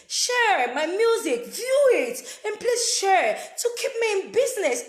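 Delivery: loud, high, insistent plea; begging. Pretend. Mother begging for money for toy cars, loud pleading. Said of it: "I'm begging you guys".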